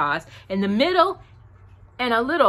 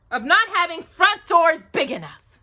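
cross; 9 semitones higher, 4 dB louder than usual. A narrator's reading. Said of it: "said Pooh crossly".

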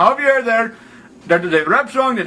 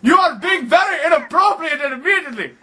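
mock outrage; 6 semitones higher than usual. Angry prank caller berates.

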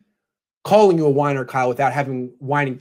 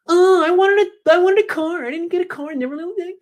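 put-on whiny teenager. Mocking Kyle as a teen wanting a car, high whine. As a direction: whimper like a fifteen-year-old denied a car. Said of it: "treat Kyle Shanahan like he's fifteen".

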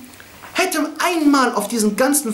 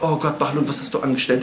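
low dismay; 7 semitones lower than usual. Acted. Caliph dismayed at escaped prisoners.

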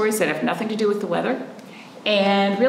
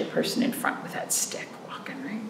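whisper; 9 dB quieter than usual. Pretend. Storyteller drops to a whisper.

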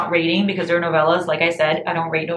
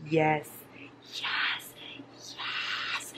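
each yes softer until whispered; fading to whisper; 10 dB quieter, much slower than usual. Pretend. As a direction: let it dissolve into a hush. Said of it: "fading repeated yes".

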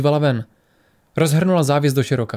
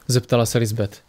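usual speaking voice

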